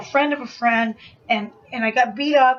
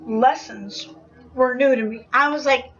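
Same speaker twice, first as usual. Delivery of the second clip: ordinary delivery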